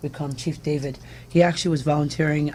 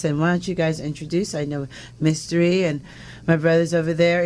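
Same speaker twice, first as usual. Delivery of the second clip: normal talking voice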